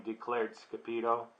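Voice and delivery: plain reading voice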